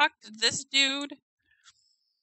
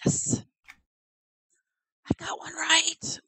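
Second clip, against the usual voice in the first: whisper; 6 semitones higher than usual. Real, not acted. Small win, said to herself.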